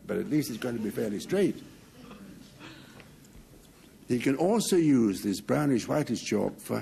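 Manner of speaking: usual speaking voice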